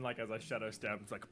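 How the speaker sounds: usual speaking voice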